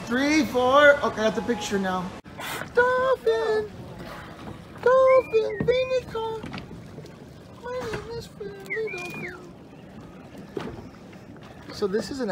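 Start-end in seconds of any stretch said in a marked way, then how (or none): 2.7-9.4 playful dolphin voice. Pretend.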